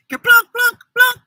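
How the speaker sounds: high bouncy plunk, plunk, plunk; plunking rain noises. Acted. Reading a picture book to kids, high playful plunks. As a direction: make the rain drops plunk. Rain sound effects in story.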